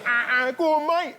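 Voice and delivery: overheard soldier's cry. Retold or imitated. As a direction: ham up a soldier's yell through the tent. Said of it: "'cause you could hear".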